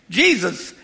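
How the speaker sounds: usual speaking voice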